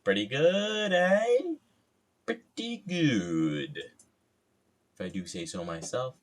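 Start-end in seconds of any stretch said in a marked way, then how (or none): 0.0-1.4 pitched way up and bright; high squeaky voice. Pretend. Hammed self-praise. 2.2-3.8 slow drawn-out voice. Pretend. Slow low self-praise.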